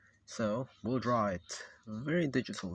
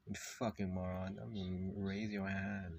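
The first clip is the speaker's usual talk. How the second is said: usual speaking voice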